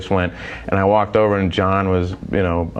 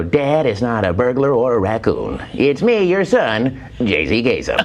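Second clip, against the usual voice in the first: mock radio-host son, melodic and swooping; J C Kasem. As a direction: introduce yourself like a junior countdown host. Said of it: "I come out and go".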